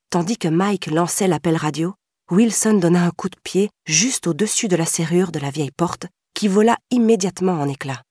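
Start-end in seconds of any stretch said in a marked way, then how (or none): none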